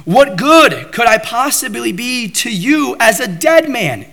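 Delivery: high, pleading cry to God; a desperate plea to the Lord, voiced high. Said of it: "he's saying".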